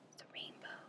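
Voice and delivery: soft unvoiced wonder; whisper. Reader whispering the rainbow reveal to children. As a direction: breathe it out in awe.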